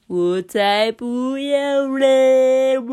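very slow, drawn-out, high loud sulky refusal; reluctant refusal. A narrator's reading. Pot answering the head chef, reluctant and sulky. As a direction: stretch it out unwillingly. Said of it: "用不情愿的声音".

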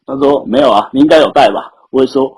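normal talking voice

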